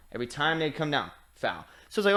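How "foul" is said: low quiet deadpan foul; mock ref call. Mimicking ref calls each possession, low deadpan. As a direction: drop it low like a bored ref.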